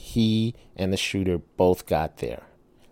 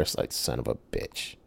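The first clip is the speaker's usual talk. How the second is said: muttered curse; 9 semitones lower, 11 dB quieter than usual. Retold or imitated.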